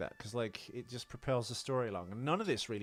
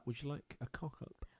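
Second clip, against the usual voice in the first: hushed conspiratorial whisper; 2 semitones higher, 8 dB quieter than usual. Acted. Cohost whispering the segment cue. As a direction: breathe it like a secret.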